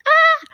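high squealed Ah, far up in pitch; a sudden shrill imitated shriek of amazement; mock scream. Reacting to the spoon video with a mock scream. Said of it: "you wanna scream".

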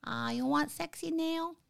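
put-on Thai accent. Fans asked for her Thai accent, done playful. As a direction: tease like a Thai nail-salon worker. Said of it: "asking me to do my Thai accent".

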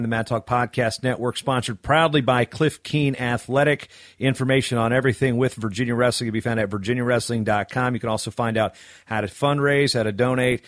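usual speaking voice

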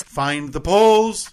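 slow, loud British voiceover mimicry; stretched, full-voiced British copy; British announcer impression. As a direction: draw it out big in a British accent. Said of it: "the way she really brought that home".

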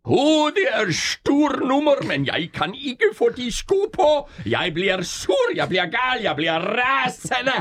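højt, fjollet klovnestemme der bygger sig op til raseri; rasende klovn. Acted. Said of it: "Hvordan vil Lars Thiesgaard gå til en rasende klovn". (rasende klovn med høj stemme)

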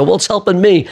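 tossing back a mock-defiant quip; cheeky retort. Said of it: "I go".

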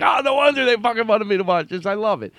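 high-pitched, gushing revelation; retold burst of delight, pitched right up; excited realization. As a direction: exclaim it as if it has just clicked. Acts out own excited reaction.

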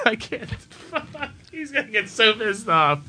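high, soft words through laughter; real laughing fit. Real, not acted. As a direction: give up, giggling helplessly. Cracks up fluffing the line.

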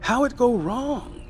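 put-on eager girl calling up to her uncle; young Lottie, eager.

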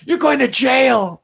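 high, slow mock shout; hammed-up arresting cop; imitating cop. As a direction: bark it like the cop.

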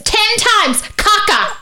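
very high, punched two-part chant; demonstrative shrieking. Retold or imitated. Getting through to non-English-speaking parents, high mock shrieking. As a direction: hammer each word up high, acting it out. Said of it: "So I start going like this".

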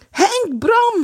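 high, loud shriek of two names. A narrator's reading. Kees screams to the two boys for help, high shriek. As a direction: scream for help across the ice. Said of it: "gilde hij".

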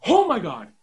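high excited squeal. Real, not acted. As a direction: burst out, thrilled. Reacts to the segment name.

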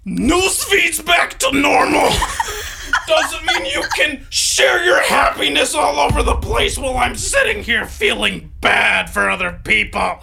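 shrill mock-hysterical social media moralist; hammed-up shrieking scold. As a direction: screech like a preachy online scold. Mocking scold again.

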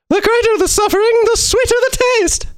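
very high, squeaky gingerbread man; shrill tiny cookie voice, played up; Gingy voice. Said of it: "No, Gingy from Shrek".

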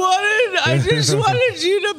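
high, slow sobbing dog voice; whiny fake crying. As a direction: whimper it like a hurt puppy.

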